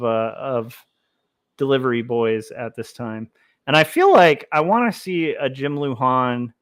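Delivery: ordinary talk, nothing marked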